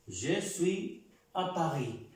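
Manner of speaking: Je suis à Paris said slowly, Paris ending in "ee" with no S; slow French model. Shown as a demonstration. Teacher to Spanish speakers, slow careful Paris with a silent S. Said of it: "La S no se pronuncia en la palabra París".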